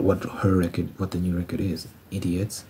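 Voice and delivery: normal talking voice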